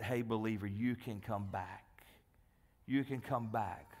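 low, soft, reassuring call; gentle invitation. Acted. The Lord calling a fallen believer back, soft and reassuring. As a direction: invite them home softly, without condemnation.

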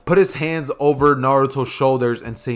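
ordinary delivery, nothing marked

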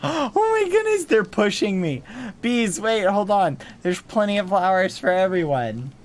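high, loud flustered protest; excitedly pleading for order; flustered exclaiming. Real, not acted. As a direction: call out over a pushy crowd. Bees pushing him in game.

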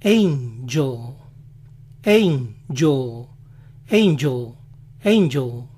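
"angel" as "EYN-juhl"; low American "angel" with dark final L; English pronunciation. Shown as a demonstration. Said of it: "Ángel es".

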